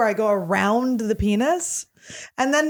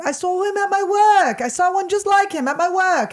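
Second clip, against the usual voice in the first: very high, hammed-up British; British accent; 9 semitones higher, 3 dB louder than usual. Shown as a demonstration. Showing off a British accent as the girl, high and hammed-up. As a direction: push the mock British accent right up high. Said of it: "This is my British accent".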